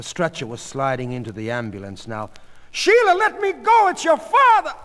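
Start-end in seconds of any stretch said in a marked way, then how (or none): 2.7-4.7 very high, loud frantic cry; desperate shout. A narrator's reading. Goldie fighting her daughter to reach her husband, desperate shout. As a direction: scream to be released. Goldie struggles toward the ambulance.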